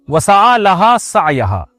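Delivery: slow, measured Arabic verse recitation; Quran recitation. Acted. Preacher quotes the Quran on making effort, slow recitation. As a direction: draw out the long vowels reverently.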